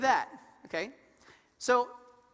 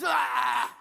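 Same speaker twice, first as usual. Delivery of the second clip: bear yell; 3 semitones higher, 6 dB louder than usual. Retold or imitated. Bear rearing up.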